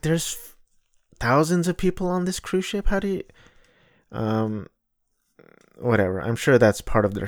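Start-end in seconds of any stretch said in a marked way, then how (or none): 0.0-3.2 incredulous protest. Retold or imitated. His exasperated reply to the waiters.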